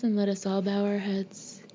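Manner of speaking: ordinary delivery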